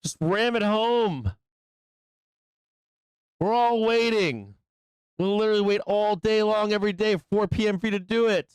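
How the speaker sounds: teasing the price to break out; coaxing the chart. Real, not acted. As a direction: nag the bar into moving. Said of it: "yelling at the fucking bars".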